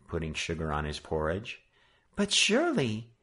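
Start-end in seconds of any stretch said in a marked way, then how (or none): 2.2-3.1 put-on girl's voice. A narrator's reading. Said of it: "she began".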